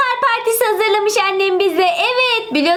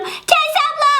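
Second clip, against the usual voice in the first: urgent call; 10 semitones higher than usual. Acted. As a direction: yell for her to come now. Child calls sister, stranger at door. (kapıdaki yabancıdan korkup ablasına bağıran çocuk)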